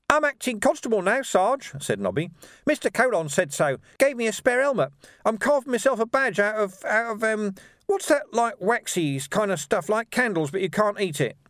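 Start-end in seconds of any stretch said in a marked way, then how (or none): 0.1-1.7 very high, eager little voice; cheeky urchin. A narrator's reading. Urchin reporting his promotion to sergeant, eager. 2.6-11.4 very high, cheeky little voice; grinning urchin. A narrator's reading. Urchin answering how he got here, grinning.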